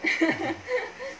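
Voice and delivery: high laugh bursting out; real laugh cracking up; burst of laughter. Real, not acted. Laughing at husband's begging. (tiếng cười bật ra)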